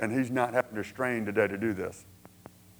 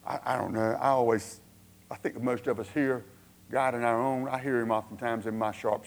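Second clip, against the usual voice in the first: ordinary delivery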